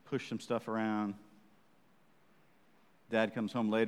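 ordinary talk

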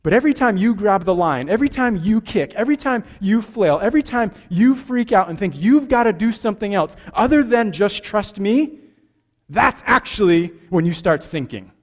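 full-force preaching. Acted. Pastor drives the point to the congregation, preaching hard. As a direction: hammer each every time home.

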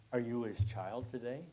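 normal talking voice

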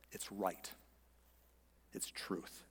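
low and very soft, nearly whispered; hushed conviction. Real, not acted. Pastor affirming the truth to congregation, hushed. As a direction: let each short phrase fall to a hush. Preacher quietly affirms his point.

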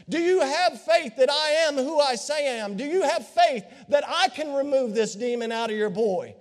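ordinary delivery

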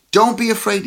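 firm call of comfort. Retold or imitated. Jesus calming terrified disciples, loud firm reassurance. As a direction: call it out firmly over the storm. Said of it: "he says".

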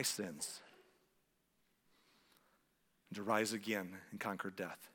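slow, subdued prayer with long pauses; slow led prayer. Shown as a demonstration. Preacher leading listeners to repeat a prayer, slowly and quietly. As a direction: lead it phrase by phrase, leaving room to repeat. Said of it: "I'll say 'em slowly".

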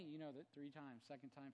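ordinary talk, nothing marked

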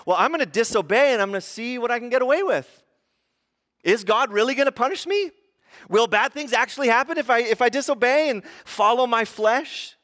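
high, loud defiant questioning. Acted. Acting out defiant sinner testing God.

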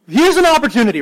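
high, loud burst of excitement; imagined delighted reaction. Pretend. Pastor reacting to a seatmate's dream question, excitedly.

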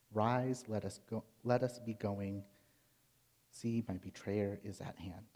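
low, soft, measured reading of the Gospel; scripture reading. Acted. Preacher reads the garden prayer to the congregation, hushed and solemn. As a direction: read it quietly, with reverence.

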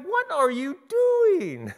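high, drawn-out exasperated question; incredulous. Acted. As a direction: protest in comic disbelief, pitch way up. Acted reaction to honking scooters.

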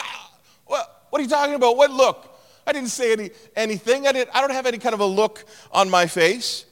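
mock-innocent outrage, voice climbing; indignant denial. Acted denial in quarrel.

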